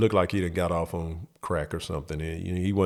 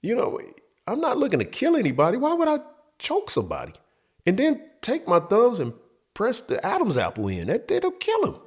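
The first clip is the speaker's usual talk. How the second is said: incredulous squeak; 12 semitones higher than usual. Real, not acted. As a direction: voice shooting up high in disbelief. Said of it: "I'm not sure that's good advice".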